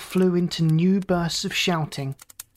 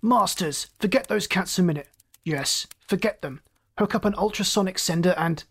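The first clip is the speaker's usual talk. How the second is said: ordinary delivery, nothing marked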